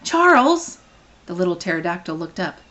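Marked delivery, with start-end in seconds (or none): 0.0-0.8 high, loud call of a name. Acted. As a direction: shout the name down below. Calling down to Charles.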